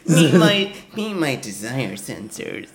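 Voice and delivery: lightly voiced dork, laughing; a hint of a geeky nerd; nerdy dork voice. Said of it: "some kind of dork".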